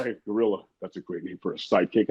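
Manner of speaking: low, soft Batman-style greeting; a hint of Adam West's smooth hello. A lightly voiced Adam West greeting the young designer in the elevator, low and soft.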